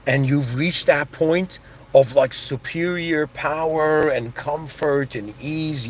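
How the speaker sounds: usual speaking voice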